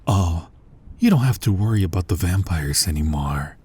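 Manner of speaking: ordinary talk, nothing marked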